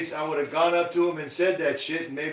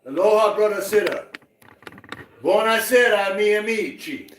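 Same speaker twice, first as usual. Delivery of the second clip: louder, higher, hammy Italian greeting; Hawaiian-Italian persona; 7 semitones higher, 6 dB louder than usual. Pretend. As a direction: play a showy Hawaiian-Italian character. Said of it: "Io sono due persone, hawaiano e italiano".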